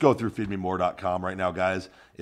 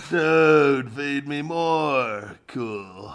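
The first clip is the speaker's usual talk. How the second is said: high, slow dazed stoner voice; 5 semitones higher, 4 dB louder, much slower than usual. Pretend. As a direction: drawl it like a baked stoner. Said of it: "That's my stoner voice".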